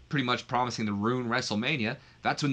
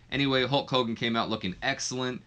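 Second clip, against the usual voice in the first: ordinary delivery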